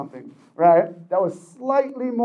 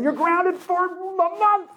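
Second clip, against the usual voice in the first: mock angry parent; 11 semitones higher, much slower than usual. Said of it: "when they're mad".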